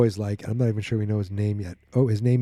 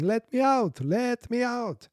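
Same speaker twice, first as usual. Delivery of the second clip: much higher pleading cry, slowing; prisoner screaming; 13 semitones higher, slower than usual. Retold or imitated. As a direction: cry out desperately from a cell. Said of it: "And you hear someone screaming".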